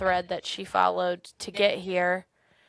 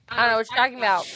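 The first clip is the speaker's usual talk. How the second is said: playing dumb, higher and louder; feigned ignorance; 4 semitones higher, 6 dB louder, much faster than usual. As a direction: imitate an overdone denial. Ned feigns ignorance about the door.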